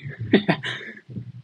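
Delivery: quiet laugh. Real, not acted. Laugh after joke.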